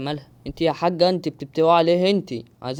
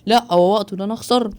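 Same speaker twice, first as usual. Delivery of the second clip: higher, louder dismayed cry; panicked outcry; 6 semitones higher, 6 dB louder than usual. Real, not acted. Gamer panicking as the timer runs out, alarmed. Panic about losing the challenge.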